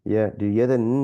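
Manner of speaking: ordinary talk, nothing marked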